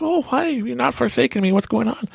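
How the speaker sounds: high, startled mock relief; squeaky surprised greeting; hammed-up relief; surprised man. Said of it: "And it's like".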